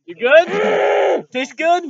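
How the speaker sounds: high, loud teasing call; teasing shout. Real, not acted. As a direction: shout it up at him, mocking. Teasing friend during the dare.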